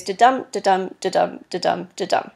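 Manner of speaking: five even da-DUM beats, stress on each "dum"; steady "da-dum" pulse with unstressed "da"; iambic rhythm. Shown as a demonstration. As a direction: chant "da-dum" five times, leaning on every "dum". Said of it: "The first is unstressed and the second is stressed".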